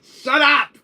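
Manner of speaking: high, loud yell; exasperated high shout; angry outburst. Acted. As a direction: shout them down. Shouting to silence the teasing.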